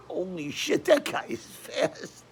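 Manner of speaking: high, slow, breathy amazement; stunned awe. Retold or imitated. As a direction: gasp it out in disbelief. Stunned by the knockout.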